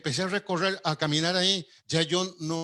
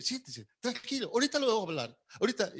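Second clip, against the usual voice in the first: higher, much softer, smiling and calming; smiling reassurance; 6 semitones higher, 5 dB quieter, faster than usual. Retold or imitated. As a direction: soothe a nervous employee with a smile. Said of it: "con una sonrisa me dice".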